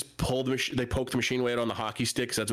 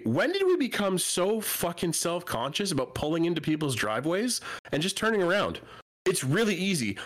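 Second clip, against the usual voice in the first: exasperated rant; 6 semitones higher than usual. Real, not acted. Rants about drivers avoiding driveways.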